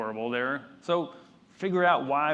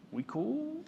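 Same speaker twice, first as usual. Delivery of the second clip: light, high, quiet rising "We cool?"; mock checking in; 12 semitones higher, 6 dB quieter than usual. Shown as a demonstration. Speaker teasing the audience after a pause, playful rising question. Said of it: "I'm running this show here".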